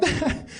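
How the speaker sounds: high, quick burst of laughter; acted laughing fit. Game master as guard reacting to the player's joke, laughing. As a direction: play the guard cracking up. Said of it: "he's rolling on the floor laughing".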